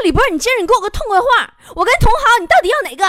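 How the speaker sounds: put-on loud, high, fast boyfriend ultimatum; imitated yelling. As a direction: yell it like a jealous, fed-up boyfriend. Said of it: "他冲我喊呐". (模仿男友又急又高声地喊)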